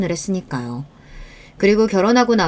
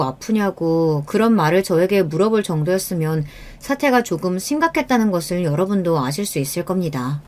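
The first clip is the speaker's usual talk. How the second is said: usual speaking voice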